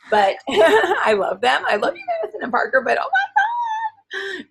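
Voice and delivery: laughing, high and stretched out; laughing exasperation. Real, not acted. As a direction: laugh through the love and the despair. Laughing about her own kids.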